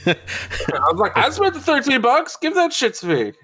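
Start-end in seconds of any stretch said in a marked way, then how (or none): 1.2-3.3 high-pitched, playful outrage; mock indignant demand. Buyer retelling a demand over thirteen bucks, mock indignant. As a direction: demand it in a high, comic squawk.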